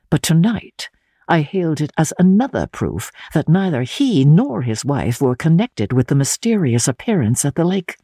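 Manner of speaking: usual speaking voice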